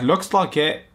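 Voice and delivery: exaggerated mocking read; mock character reply.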